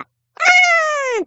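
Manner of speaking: long high drawn-out moan; mock moan. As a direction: moan it out slowly, hammed up. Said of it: "もだえてね".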